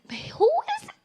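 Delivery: high squeaky incredulous question; mock disbelief. Comic asking friends who the preacher is, squeaky and high.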